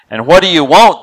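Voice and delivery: put-on busy pharmacist snapping at a little girl, annoyed. A narrator's reading. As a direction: brush the girl off, irritated. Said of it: "in an annoyed tone of voice".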